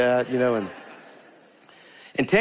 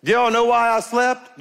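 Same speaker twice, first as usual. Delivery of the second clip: full-force preaching; 10 semitones higher than usual. Acted. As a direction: lift it up and drive it home to the church.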